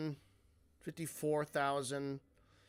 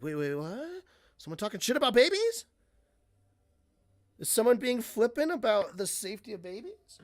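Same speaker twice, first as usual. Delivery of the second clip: higher, louder, fast scandalised gasping; mock outrage; 6 semitones higher, 5 dB louder, faster than usual. People reacting to a joke about babies, mock outrage. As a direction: play a busybody suddenly scandalised.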